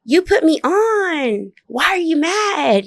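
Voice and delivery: high, slow, drawn-out plea; exasperated protest. Retold or imitated. Asking the man who put her on, exasperated protest. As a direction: stretch each phrase out high in disbelief.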